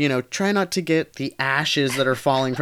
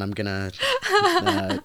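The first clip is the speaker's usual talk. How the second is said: laughing. Real, not acted. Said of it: "laugh cry".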